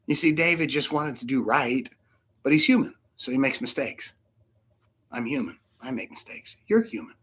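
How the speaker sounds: normal talking voice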